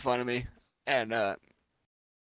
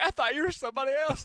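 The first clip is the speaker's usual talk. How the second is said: high fast retort; 11 semitones higher, faster than usual. Retold or imitated. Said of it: "I'm like".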